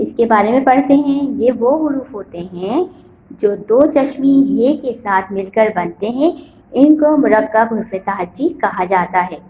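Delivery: ordinary delivery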